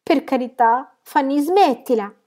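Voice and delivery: high, loud, aghast plea; shocked cry, slowing on the order to stop; dismayed exclamation. A narrator's reading. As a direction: implore loudly, appalled. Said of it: "esclamò Lucy sbigottita".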